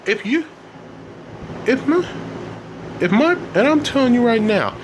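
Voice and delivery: high, heated accusations; angry outburst. A narrator's reading. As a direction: argue back like an upset teenager. Elliot argues back in anger.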